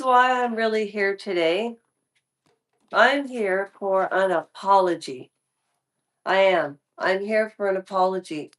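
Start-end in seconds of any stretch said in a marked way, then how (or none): none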